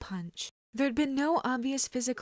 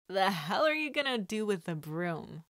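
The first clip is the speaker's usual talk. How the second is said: snickering thug. Acted. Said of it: "the kidnapper snickers".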